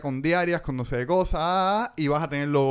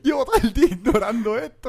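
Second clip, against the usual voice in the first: laughing; 9 semitones higher, 5 dB louder than usual. Real, not acted. Laughing while retelling.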